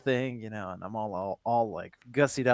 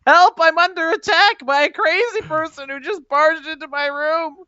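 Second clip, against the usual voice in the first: very high, loud shriek for help; shrieking down from a penthouse balcony; screaming for help; 8 dB louder than usual. Acted. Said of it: "I start screaming".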